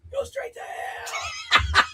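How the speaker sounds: very high, slow laughing shriek; laughing mock scream. Joins the screaming bit.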